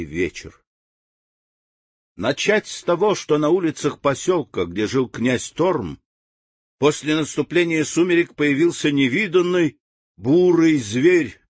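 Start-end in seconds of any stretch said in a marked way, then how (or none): none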